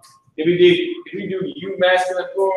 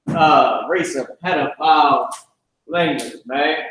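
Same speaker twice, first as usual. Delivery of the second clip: ordinary talk, nothing marked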